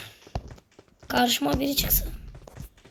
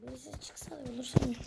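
hushed whisper while sneaking; 11 dB quieter, faster than usual. Real, not acted. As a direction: whisper so no one hears.

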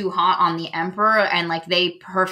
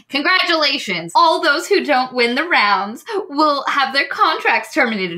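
chipper ringmaster; 6 semitones higher than usual. Retold or imitated. Circus mistress announcing to performers, chirpy hammed-up announcement. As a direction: sell bad news with a bright show voice. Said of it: "then she's like".

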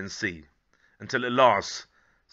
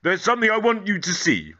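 Grandad's voice; 10 semitones higher, 8 dB louder than usual. Acted. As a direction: call out like a delighted grandad finding the boy. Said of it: "said Grandad".

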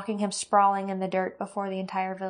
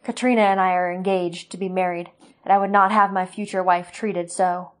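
loud declaration; 7 dB louder than usual. Acted. Roran announcing his engagement to the gathered villagers, loud. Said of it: "declared so all could hear".

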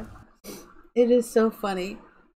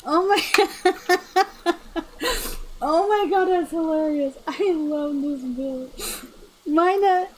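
laughing to tears; 8 semitones higher than usual. Real, not acted. Streamer tearing up laughing at a viewer's build. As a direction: talk through tears of laughter. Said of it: "I'm tearing up".

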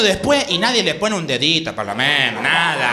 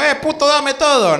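street thug; 6 semitones higher than usual. Retold or imitated. Mugger threatening in Lanús.

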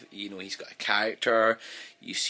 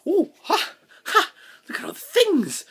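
very high, loud gleeful gasp; laughing excited imitation; giddy wonder; 7 dB louder than usual. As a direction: squeal with delight at everything. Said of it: "Clara kinda going".

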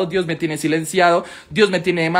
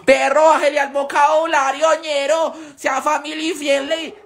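imitating a scolding follower; 6 semitones higher, slower than usual. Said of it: "A mí muchas veces me han dicho como".

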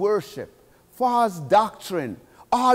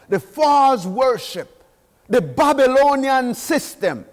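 preaching at full force; 4 dB louder, slower than usual. Acted. Preacher proclaiming Babylon's fall to the church, full force. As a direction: thunder it out to the congregation.

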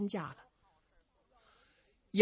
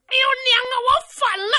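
much higher, loud yell to mother; bursting-in shout; 8 dB louder, much faster than usual. Acted. Zhu Can bursts in to his mother, loud high yell. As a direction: burst through the door hollering.

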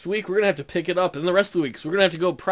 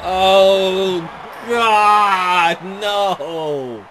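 anguished shout; 5 dB louder, much slower than usual. Real, not acted. Touchdown against his team.

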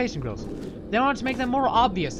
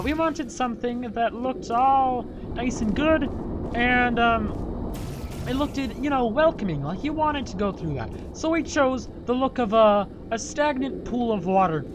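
put-on Jamaican accent; 5 semitones higher than usual. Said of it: "Why am I doing a Jamaican accent?".

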